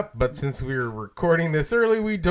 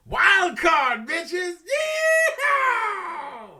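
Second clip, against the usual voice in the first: high laughing whoop; whooping yell; much slower than usual. Real, not acted. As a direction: cackle it out wild.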